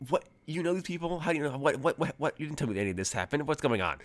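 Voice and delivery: high-pitched, rapid disbelieving questions; shocked agent. Acted. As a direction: pepper her with alarmed questions.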